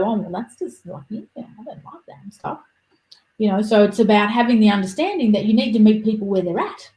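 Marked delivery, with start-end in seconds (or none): 0.0-2.6 muttered aside. Retold or imitated. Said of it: "it's not up to me to go".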